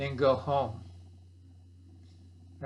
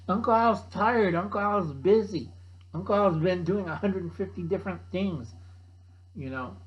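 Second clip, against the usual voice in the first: ordinary delivery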